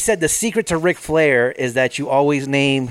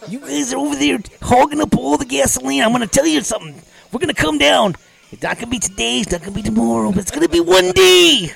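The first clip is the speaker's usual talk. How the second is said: wrestler promo voice; 9 semitones higher than usual. Pretend. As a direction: build it like a wrestler's threat. Wrestling promo about gasoline.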